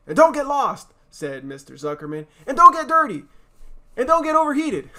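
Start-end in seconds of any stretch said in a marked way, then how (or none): 0.1-0.9 high, loud call; a parent calling out don't get lost; loud warning. Acted. 2.5-4.9 high, loud nagging; mother calling out fussy warnings; fussing mother. Acted.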